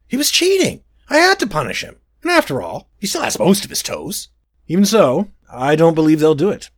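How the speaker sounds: high, whiny royal voice; a spoiled prince defending himself; petulant prince. Acted.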